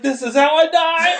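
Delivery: high wail breaking into laughter; mock doom through laughs; laughing mock despair. Retelling drunken fear of choking, high laughing mock despair.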